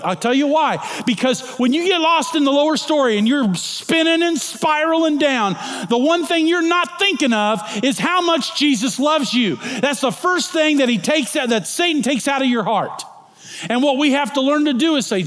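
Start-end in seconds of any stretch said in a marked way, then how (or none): 1.0-13.0 high, loud preaching; preaching at full force. Acted. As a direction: drive the message home from the pulpit.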